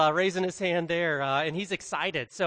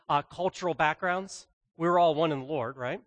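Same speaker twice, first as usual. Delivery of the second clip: ordinary delivery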